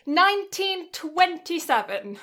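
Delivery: high, loud and slow announcement; excited reveal. Real, not acted. Revealing the Google answer to her friend, excited reveal. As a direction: unveil the year like a prize. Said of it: "It actually comes up on Google search".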